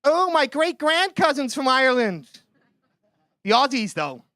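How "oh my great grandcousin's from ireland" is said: higher-pitched gushing Yank; mock American accent. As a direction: imitate an American thrilled to have Irish roots. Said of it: "Some of the Yanks love us. They're like".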